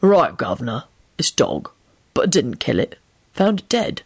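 low, glum street seller; gloomy pieman. A narrator's reading. Pieman admitting the pie is dog, gloomy and low. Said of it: "greet them in a gloomy voice".

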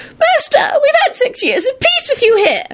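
put-on very high, wailing old-witch voice; wailing elderly witch. A narrator's reading. Elderly witch begs the minister to stay, wailing high. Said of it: "an elderly witch wailed".

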